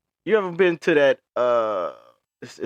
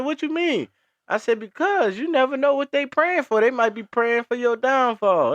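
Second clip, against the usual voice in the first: put-on high old lady voice pitched up; imitating the lady; 14 semitones higher than usual. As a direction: keep playing the lady explaining.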